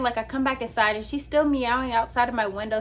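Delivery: ordinary delivery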